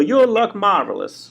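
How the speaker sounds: high, drawn-out catchphrase impression; showy comic impersonation, slow and high-pitched; Billy Crystal. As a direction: stretch it out in a hammy showman voice. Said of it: "oh, Billy Crystal".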